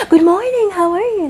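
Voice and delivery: loud, high-pitched mock American yell; shouted greeting. Kenyan mimicking Americans yelling simple greetings, shouted. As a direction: belt out a greeting far too loudly. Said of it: "mbona wana have kushout".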